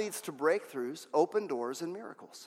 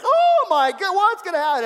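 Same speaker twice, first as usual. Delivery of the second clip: high, loud mock panic; hammed-up shriek of a panicking servant; freaking out; 12 semitones higher, 8 dB louder than usual. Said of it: "Elisha's servant is freaking out".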